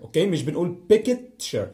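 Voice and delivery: ordinary delivery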